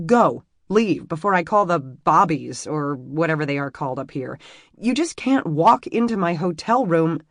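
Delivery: loud frightened order. Acted. Emmy ordering the stranger out of her room, loud. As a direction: drive him out of the room.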